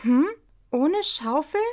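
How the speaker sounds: high, curious bunny voice; little hare voice. A narrator's reading. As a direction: ask like a small hare. Hopsi fragt in Figurenstimme. (hohe, neugierige Häsinnenstimme)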